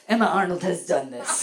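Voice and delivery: badly faked old French prostitute; elderly French madam, accent slipping; botched French accent. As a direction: attempt a world-weary French accent. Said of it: "like a ninety-year-old French prostitute".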